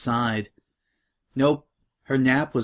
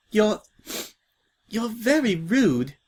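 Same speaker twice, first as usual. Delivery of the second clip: a hint of sniffly, hurt reply, higher and slow; a touch of tearful indignation; sniffling; 8 semitones higher, slower than usual. Crying new girl to Scratch, mildly sniffling. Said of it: "the bundle sniffled".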